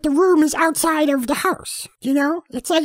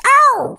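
high, loud hammed-up cry; mock death scream; 10 semitones higher, 6 dB louder than usual. Girl on the hammock stabbed, hammed-up loud scream. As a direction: yelp as the blade goes in.

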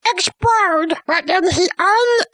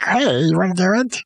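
deep Doctor Manhattan voice; 10 semitones lower than usual. Retold or imitated. Doctor Manhattan asks Laurie to hang out, voiced much lower. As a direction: drop the voice way down like a big blue hero.

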